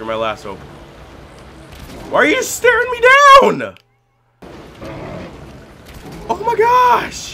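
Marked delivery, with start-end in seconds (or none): 2.1-7.3 much higher, louder panicked voice; panicked squeal. Real, not acted. Gamer stared down by an enemy bear, panicked squeal.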